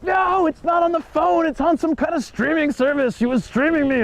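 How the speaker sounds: high-pitched hammed-up hysterical lady; frantic drunk lady. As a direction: squeal it like a drunk lady losing it. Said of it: "she's going mental".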